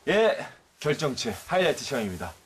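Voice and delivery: upbeat emcee announcement; show host announcement. Acted. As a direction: introduce the segment with flair.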